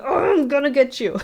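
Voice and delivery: high-pitched mock growl of a threat; Eustace. Eustace chasing Courage after the slapping, high mock threat. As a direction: imitate a cartoon chaser, pitched well up. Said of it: "and Eustace going".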